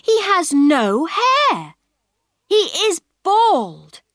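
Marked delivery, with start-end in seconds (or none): none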